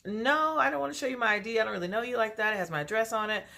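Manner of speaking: high-pitched, quick mock refusal; playing around. Refusing a suspicious date the ID, high and playful. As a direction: brush the request off playfully, voice pitched right up. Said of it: "still trying to play around".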